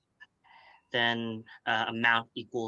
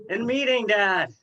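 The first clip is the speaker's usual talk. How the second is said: calling out; 11 semitones higher, 8 dB louder than usual. Real, not acted. Calling back to dad again.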